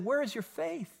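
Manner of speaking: soft, high-pitched gentle question; hushed calm, imitating an unruffled teacher. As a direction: ask it very calmly, barely raising the volume. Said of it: "looked at them very calmly and said".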